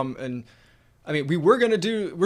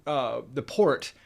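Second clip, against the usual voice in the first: ordinary talk, nothing marked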